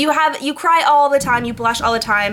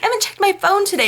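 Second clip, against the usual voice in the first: mock airheaded Anna; 9 semitones higher, faster than usual. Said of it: "She's like".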